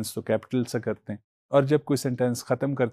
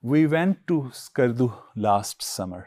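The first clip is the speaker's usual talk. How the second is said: slow example read; much slower than usual. Shown as a demonstration. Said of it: "देखिए example के अंदर".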